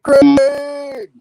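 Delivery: loud, laughing shout of a name; shocked shout. Real, not acted. Co-host shouting at the storyteller, laughing outrage. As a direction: cry the name out, scandalised.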